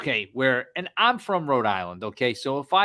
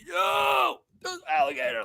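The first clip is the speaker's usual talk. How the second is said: retold yell. Son yells to dad about the alligator nearby, retold alarmed yell. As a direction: call out a warning, voice pitched way up. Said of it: "I start yelling".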